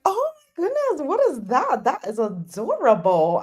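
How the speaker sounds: high squealed mock delight; acted-out swooning; gushing reaction. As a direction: gush in a high pitch, easing down. Acting out own gushing reaction.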